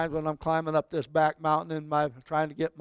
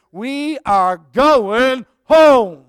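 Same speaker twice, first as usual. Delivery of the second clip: slow, drawn-out, much louder proclamation; triumphant cry; 6 semitones higher, 12 dB louder, much slower than usual. Real, not acted. Pastor assuring the congregation, slow triumphant shout. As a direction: stretch it out and lift the room. Preaching climax.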